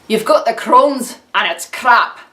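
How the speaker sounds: put-on blunt, amateurish Scottish accent. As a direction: deliver bad news in rough Scottish.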